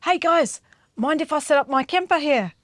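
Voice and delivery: put-on Māori man's accent, pitched a little up. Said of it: "I'll try and do the accent".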